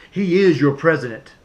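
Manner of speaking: ordinary talk, nothing marked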